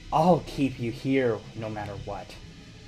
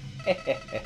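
evil cackle; 9 dB quieter than usual. Acted. Villain reveal laugh.

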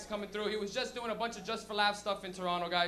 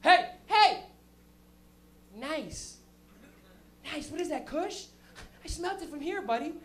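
a hint of a cheery old lady, first hey loud and high; friendly old lady; 5 semitones higher, 4 dB louder, slower than usual. Old lady smelling weed greets a smoker, lightly voiced. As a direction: call out warmly like a neighbour. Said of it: "You just be like".

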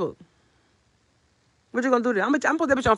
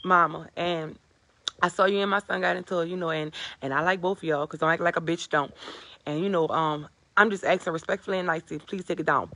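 lower, softer mock-polite mama voice; a hushed, lowered imitation of a mother asking nicely; imitating his mother; 7 semitones lower, 5 dB quieter than usual. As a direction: put on a meek, overly respectful mother pleading softly. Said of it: "Your mama gonna call my phone like".

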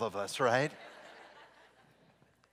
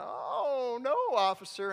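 higher, sweet denial; innocent driver; 11 semitones higher, 6 dB louder, slower than usual. Retold or imitated. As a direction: play innocent to the cop. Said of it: "And the cop says".

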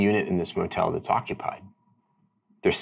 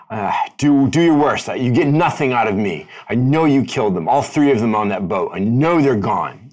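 louder, higher defiant retort in an argument; raised voice, defiant; 3 semitones higher, 5 dB louder than usual. Acted. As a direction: raise your voice like a cornered captive refusing to talk. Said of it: "you hear raised voices".

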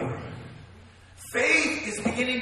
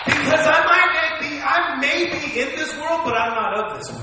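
excited preaching; 3 semitones higher, 7 dB louder than usual. Real, not acted. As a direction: preach it out at full voice, truly fired up. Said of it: "Oh man, I'm getting excited".

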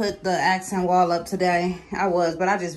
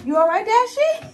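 pet talk; 13 semitones higher, 6 dB louder than usual. Real, not acted. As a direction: coo at a frightened pet. Checks on scared dog Dashy.

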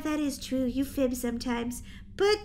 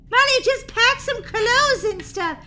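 high, loud, drawn-out order; exasperated order; 8 semitones higher, 7 dB louder than usual. Acted. Sister fed up with packing ideas, exasperated order. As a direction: order her loudly, pitch up.

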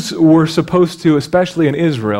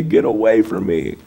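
leper calling out; 5 semitones lower than usual. Retold or imitated. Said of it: "I used to have to say".

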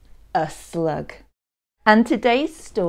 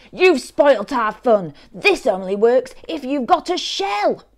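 loud, high telling-off; crying out crossly; 7 semitones higher, 7 dB louder than usual. Acted. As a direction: scold the slug who spoilt the game. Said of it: "they cried".

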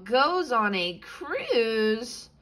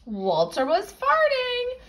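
comic reveal. A narrator's reading.

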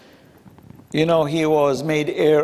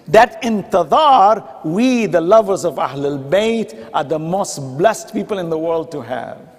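fervent preaching. Acted. Preaching at full force.

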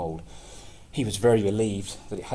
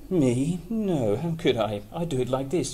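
goat voice; 5 semitones higher, 5 dB louder than usual. Acted. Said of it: "she replied".

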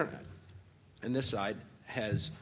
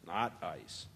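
usual speaking voice

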